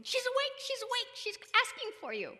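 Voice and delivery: high, loud, urgent announcement; excited nurse. Retold or imitated. Nurse rushing to tell the parents she's awake, excited. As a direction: call it out as if running in.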